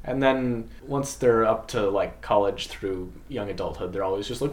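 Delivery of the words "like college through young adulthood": ordinary talk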